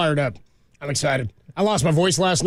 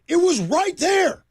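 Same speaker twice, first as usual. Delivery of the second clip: retold yell; 14 semitones higher, much slower than usual. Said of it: "And I yelled".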